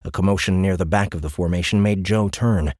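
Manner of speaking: ordinary delivery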